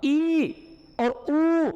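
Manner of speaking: high ई and ऊ sounds; vowel demo. Shown as a demonstration. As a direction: voice both vowels clearly. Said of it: "मैंने अभी बोला था कि ई".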